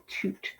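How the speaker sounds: "toot" said alone, softly; quiet isolated "toot", long U; single syllable. Shown as a demonstration. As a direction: sound out just "toot". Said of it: "Your third syllable".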